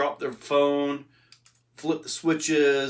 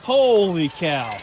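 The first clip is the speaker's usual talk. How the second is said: amazed exclamation; 3 semitones higher, 8 dB louder, slower than usual. Real, not acted. Reacting to the jump.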